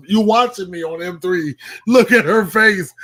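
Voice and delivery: excited, rising to a loud near-shout; excited retelling. Retold or imitated. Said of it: "I was like".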